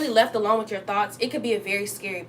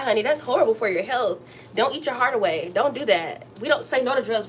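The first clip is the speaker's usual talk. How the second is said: usual speaking voice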